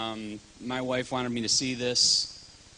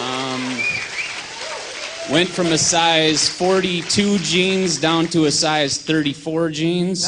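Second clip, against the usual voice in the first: proud; 4 semitones higher, 7 dB louder than usual. Real, not acted. Proud announcement of weight loss.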